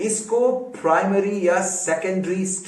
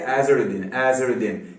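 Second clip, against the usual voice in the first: English pronunciation; 4 semitones lower than usual. Retold or imitated. Teacher contrasting their way with ours, English-accented name. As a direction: lower English-accented AZ-er-a-din. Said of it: "वो कहते हैं".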